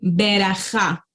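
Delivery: berajá slowly, with a clear E after the b. Shown as a demonstration. Teacher modelling the word berajá for students. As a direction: say berajá slowly, sounding the Sheva as E. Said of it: "como por ejemplo en la palabra".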